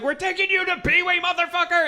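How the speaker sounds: high, loud mock yell; dad yelling. Dad dragging kid to Pee Wee, loud mock yell. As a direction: scream it like a furious sports dad.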